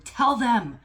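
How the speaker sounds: loud, high, urgent exhortation as God; impassioned shout, insisting on every word; screaming command. Retold or imitated. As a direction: cry it out like a divine order. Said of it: "God is, like, screaming".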